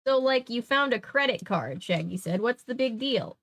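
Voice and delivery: animated read-aloud, deliberate phrasing; story narration. A narrator's reading. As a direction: read the mystery out to listeners with lift.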